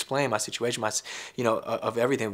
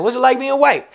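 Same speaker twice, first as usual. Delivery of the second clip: mocking interviewer; 11 semitones higher, 9 dB louder than usual. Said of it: "Hot ninety-seven".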